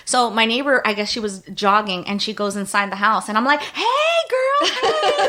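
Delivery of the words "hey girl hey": very high laughing call; laughing greeting. Retold or imitated. Hanging out the window to the jogging neighbor, high laughing hail. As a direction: squeal a greeting through laughter.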